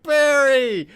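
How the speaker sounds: high, loud drawn-out mock cry; calling out. Wally calling after Barry as he runs away, high cry. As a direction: holler the name after someone, way up high.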